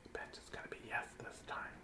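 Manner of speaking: hushed secretive whisper; conspiratorial whisper. Real, not acted. Secret aside about puppy hopes.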